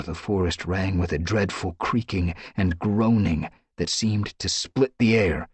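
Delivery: ordinary delivery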